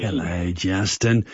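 lightly voiced old grandpa greeting. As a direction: greet him like a grandpa, slow and slightly low. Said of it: "It's my grandpa voice".

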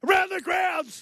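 high-pitched announcer shout; segment call-out. Pretend. Calls out the segment name.

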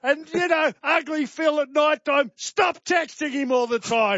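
worked-up telling-off, pitch riding high; angry rant. Real, not acted. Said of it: "You've become angry today".

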